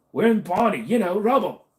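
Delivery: put-on high, loud overdone Cockney accent; hammed-up bad Cockney accent; Don Cheadle's bad Cockney. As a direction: butcher the Cockney accent for laughs. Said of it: "His was so bad".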